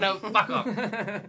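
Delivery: high, laughing "fuck off". Real, not acted. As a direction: laugh it out slowly. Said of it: "Cue up to fuck off".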